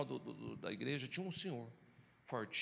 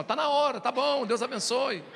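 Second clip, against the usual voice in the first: raised, loud attempt to end the hug; hurried brush-off; 8 semitones higher, 8 dB louder, slower than usual. Pastor pushing him off during an endless hug, loud brush-off. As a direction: wrap it up loudly, pitched well up.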